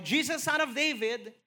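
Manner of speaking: high, loud plea for mercy; a blind man pleading loudly to Jesus; crying out. Retold or imitated. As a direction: call out to him, pleading. He started crying out.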